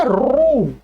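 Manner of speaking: रू said as r plus long oo; common mispronunciation रू. Shown as a demonstration. Teacher showing what others say, wrong रू. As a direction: say रू with a plain long oo ending. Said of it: "कुछ लोग इसको बोलते हैं".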